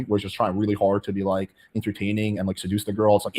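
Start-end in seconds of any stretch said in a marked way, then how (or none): none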